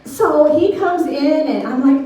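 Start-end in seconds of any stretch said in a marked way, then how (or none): none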